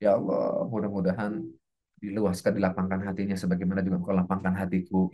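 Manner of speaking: low, subdued supplication; low murmured blessing; heartfelt prayer. Shown as a demonstration. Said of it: "iringi dengan doa-doa kita untuk dia".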